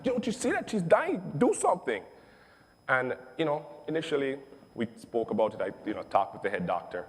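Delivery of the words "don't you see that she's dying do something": angry outburst. Retold or imitated. Father yelling at staring nurses, furious high shout. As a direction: snap at them to act now. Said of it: "And I got so mad".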